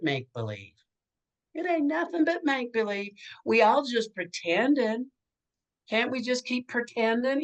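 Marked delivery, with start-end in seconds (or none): 1.5-7.4 put-on much higher, playful old-actress voice; Dodie Goodman voice. A veteran actress coaching the teen star, high and playful. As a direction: sing out the advice like a breezy veteran actress. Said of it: "as Dodie Goodman used to say to me".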